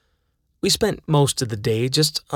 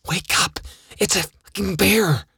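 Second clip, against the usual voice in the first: whisper yelling; 7 semitones higher than usual. Retold or imitated. Waking friend as bear circles tent.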